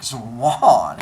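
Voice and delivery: low, drawn-out swan reply; suave swan. Retold or imitated. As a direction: honk it low at her. Swan answers Leto.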